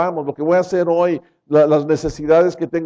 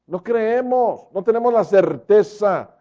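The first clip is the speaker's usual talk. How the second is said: normal talking voice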